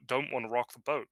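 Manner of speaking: normal talking voice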